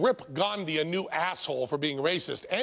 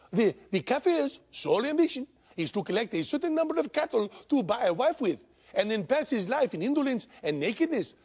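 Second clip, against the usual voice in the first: put-on Indian accent, pitched up; bullshit Indian accent; 5 semitones higher than usual. Comedian voicing a Gandhi puppet in a mock Indian accent. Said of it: "my bullshit Indian accent".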